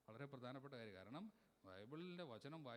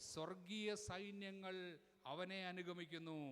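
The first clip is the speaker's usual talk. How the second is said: high, loud, sustained preaching call; scripture proclamation; 6 semitones higher, 6 dB louder, slower than usual. Acted. Preacher repeats the verse for the congregation, loud drawn-out proclamation. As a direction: ring out each phrase, holding the words long.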